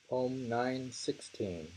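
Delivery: ordinary talk, nothing marked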